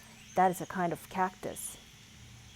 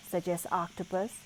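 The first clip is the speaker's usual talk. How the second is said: plain reading voice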